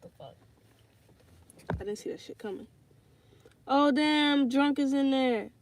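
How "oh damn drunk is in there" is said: excited greeting. Real, not acted. Spots viewer Drunk in chat.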